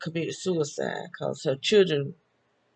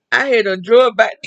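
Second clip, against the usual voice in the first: excited loud retelling; 6 semitones higher, 11 dB louder than usual. Retold or imitated. Retelling fighting back mother.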